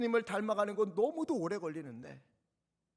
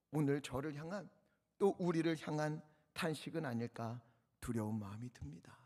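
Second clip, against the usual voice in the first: ordinary talk, nothing marked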